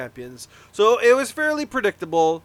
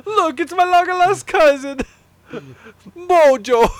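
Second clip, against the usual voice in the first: mock Italian accent; 9 semitones higher, 6 dB louder than usual.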